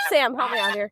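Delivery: normal talking voice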